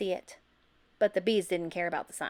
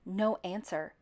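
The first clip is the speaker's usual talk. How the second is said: plain reading voice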